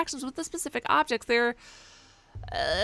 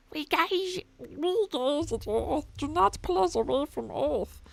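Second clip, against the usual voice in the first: character voice; 4 semitones higher, much slower than usual. Acted. Reading a character line.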